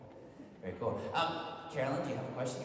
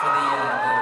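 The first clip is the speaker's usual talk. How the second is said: sudden shout; 15 dB louder, much faster than usual. Real, not acted. Sudden shout over music.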